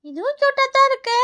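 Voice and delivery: put-on high, childlike admiration; delighted child. Child admiring another toy tree, delighted. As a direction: coo over it in a high little voice.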